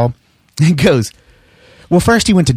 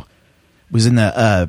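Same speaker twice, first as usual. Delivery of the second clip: usual speaking voice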